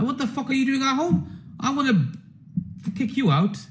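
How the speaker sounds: husband's indignant inner rant, lightly voiced; irritated husband. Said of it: "her husband's thinking".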